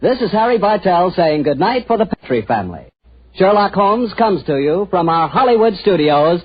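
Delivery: put-on crisp old-time radio credits read; formal announcer voice over music. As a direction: announce the credits like a 1940s broadcast. Announcer sign-off.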